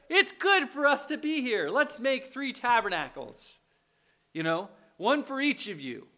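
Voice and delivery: mock-eager suggestion from an overeager disciple; voicing Peter. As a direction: blurt it out, a touch over-keen. Voicing Peter's dumb blurt.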